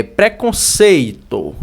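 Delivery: PRECONCEITO drawn out, leaning loudly on "CEI"; loud, lengthened "cei" in preconceito; slow sounding-out. Shown as a demonstration. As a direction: stretch preconceito slowly, syllable by syllable. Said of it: "ó, seito".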